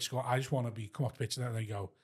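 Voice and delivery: ordinary talk, nothing marked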